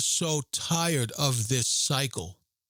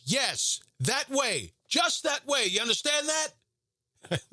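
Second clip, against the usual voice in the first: high, slow barked sergeant yell; drill sergeant shout; 11 semitones higher, slower than usual. Retold or imitated. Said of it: "he says".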